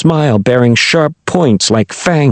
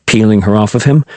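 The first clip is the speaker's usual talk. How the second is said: plain reading voice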